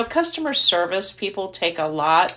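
ordinary talk, nothing marked

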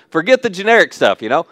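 normal talking voice

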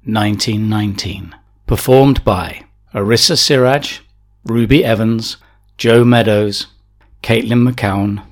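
ordinary delivery

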